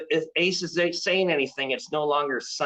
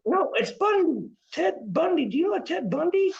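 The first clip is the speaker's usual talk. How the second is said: high, wavering spirit-reader voice; psychic medium voice; 11 semitones higher than usual. Pretend. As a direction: channel spirits like a stage psychic. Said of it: "I do readings".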